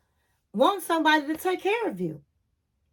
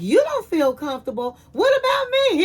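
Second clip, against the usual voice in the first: indignant echo; 6 semitones higher than usual. Retold or imitated. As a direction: throw his words back at him in disbelief. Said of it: "she was like".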